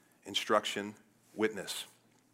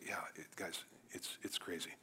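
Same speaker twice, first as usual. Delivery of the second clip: near-whisper, very soft and lower; hushed admission; 6 semitones lower, 15 dB quieter than usual. Acted. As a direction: admit it under your breath. Christians sheepishly admitting it's crazy.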